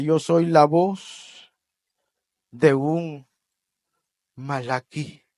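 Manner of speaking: slow, weighty pauses; slow solemn declaration. Acted. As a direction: lay each word down gravely. John's answer, slow and solemn. (declaración lenta y solemne)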